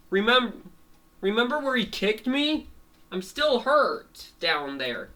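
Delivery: high-pitched mock complaint; aggrieved protest. Cat explaining why he can't fight, aggrieved protest. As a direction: plead the injury to impatient friends.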